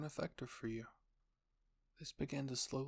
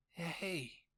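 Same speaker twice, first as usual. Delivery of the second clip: higher, huffy "hey"; huffed protest; 6 semitones higher than usual. A narrator's reading. You, relieved of the crate by Historia, huffy. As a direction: protest with a put-out huff. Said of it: "you huffed".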